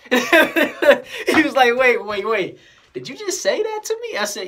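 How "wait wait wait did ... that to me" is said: loud, high, incredulous outburst; imitated astonishment. Customer double-checking what the cashier said, astonished. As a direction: stop them in mock amazement, voice climbing. Acts out customer's amazed reaction.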